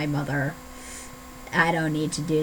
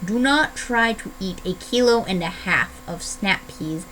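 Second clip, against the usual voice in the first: ordinary talk, nothing marked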